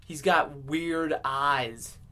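ordinary delivery

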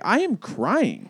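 "I am crying" slow, AM high and drawn out; proud and slow, pushing the word "am"; stress on AM. Retold or imitated. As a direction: lean hard on "am" in "I am crying", drawn out slowly. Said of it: "And I'm like".

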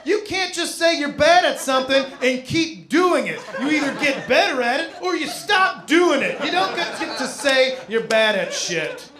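loud, laughing lecture; ranting lecture. Retold or imitated. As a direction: scold her, loud and exasperated. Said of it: "I'm like".

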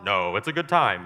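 low, loud reassurance; low voice of Jesus; Jesus answering. Acted. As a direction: answer deep and sure. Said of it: "And he'd be like".

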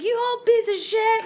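high, loud mock rage; retold angry yell. Mom yelling at the couple who took her parking spot. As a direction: scream the insult like mom did. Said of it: "she was just going on".

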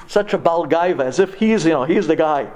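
ordinary talk

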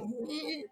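tiny high soft whine; goblin's stunned hum. Acted. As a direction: squeeze out a small high "mm". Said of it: "He's like".

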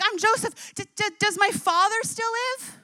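high, stammering, choked voice; tearful plea. Acted. Joseph asking his brothers about their father, tearful. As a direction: stumble over the words as tears come. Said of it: "he's crying out in brokenness".

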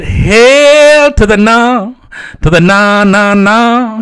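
long, drawn-out refusal, pushed up high and loud; mock emphatic refusal. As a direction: refuse with hammed-up stretched vowels. Acted refusal to share joint.

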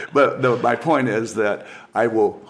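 ordinary talk, nothing marked

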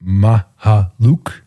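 "Mahaluk" split into slow, separate syllables MA-HA-LUK; corrected pronunciation. Shown as a demonstration. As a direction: sound out "Mahaluk" slowly, syllable by syllable. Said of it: "there's a real pronunciation".

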